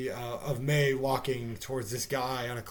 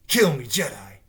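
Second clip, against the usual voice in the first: loud, stilted mock line reading; imitating actress's delivery; 7 dB louder than usual. Reviewer mocking the lead actress's line, imitated bad acting. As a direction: mimic a bad actress's challenge, louder than usual. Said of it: "that's exactly how she said it".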